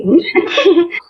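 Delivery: bright high burst of laughter. Real, not acted. Mother laughing at her daughter's first-day story, high laugh.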